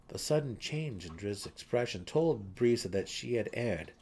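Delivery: ordinary delivery, nothing marked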